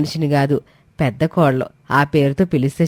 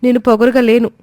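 high and louder, insisting on the truth; protesting young wife; 7 semitones higher, 4 dB louder than usual. Acted. Komali protesting against being called arrogant, high and loud. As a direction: refuse the accusation, desperate. Daughter-in-law protests accusation.

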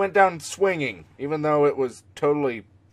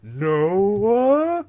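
louder, higher calling for Noah; 4 semitones higher, 4 dB louder than usual. Acted. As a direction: call out across a distance. Calling out a reference line.

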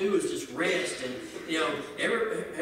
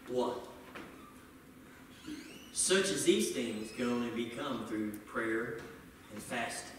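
quiet Jesus reply; 11 semitones lower, 8 dB quieter than usual. Retold or imitated. Jesus quoted in sermon.

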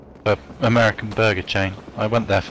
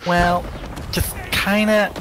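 put-on high, airy Valley Girl lilt; Valley Girl accent, pitched up; 10 semitones higher, slower than usual. Shown as a demonstration. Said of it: "really Valley Girl accent".

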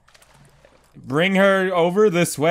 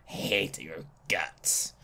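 much lower, softer and slow, a dark mutter; low muttered grudge; 12 semitones lower, 15 dB quieter, slower than usual. Real, not acted. Gamer muttering at the monster, low and slow.

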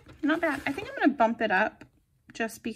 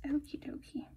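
soft unvoiced whisper to herself; whispered okie dokie; 12 dB quieter than usual. Real, not acted. Planner crafter whispering to herself while cutting stickers. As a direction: breathe it out under her breath.

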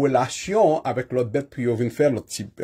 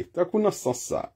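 ordinary talk, nothing marked

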